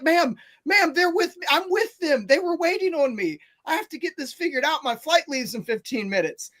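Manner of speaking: high-pitched, flustered explaining; pleading. Retold or imitated. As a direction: plead your case fast to the clerk. Pleading with the yelling clerk.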